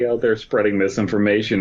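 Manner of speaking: ordinary talk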